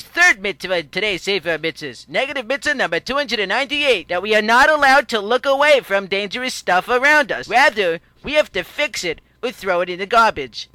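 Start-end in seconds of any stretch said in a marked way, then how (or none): none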